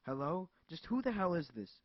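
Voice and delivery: loud, sharp demand; furious, she challenges the caller hard; angry. Acted. Angry woman shouting down the phone at Bertram. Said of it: "anger building up in her".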